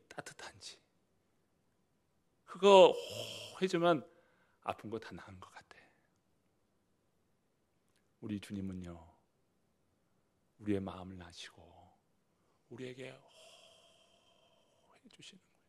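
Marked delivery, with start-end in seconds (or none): 2.5-5.8 tender hush. Real, not acted. Savoring mother's healing breath. 12.7-15.6 whisper. Real, not acted. Preacher drops to a whisper.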